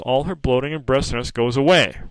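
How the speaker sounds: normal talking voice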